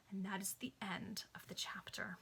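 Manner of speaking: a soft whisper to close it; whispered chapter end. Real, not acted. Reader ending the chapter for listeners, whispering. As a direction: hush it out like a goodnight.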